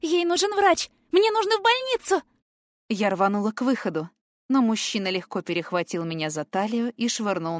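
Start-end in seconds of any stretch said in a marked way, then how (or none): 0.0-2.2 piercing high scream. A narrator's reading. Girl dragged from her dying friend, screaming. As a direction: scream no with everything you have. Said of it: "Я так билась и кричала".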